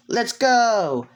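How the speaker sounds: high, drawn-out cheer; gleeful high call to set off; excited rallying cry. Acted. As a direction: cheer them on as you hop aboard. Character jumps on friend's back, cheering.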